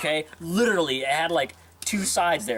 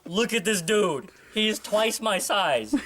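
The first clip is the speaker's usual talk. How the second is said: usual speaking voice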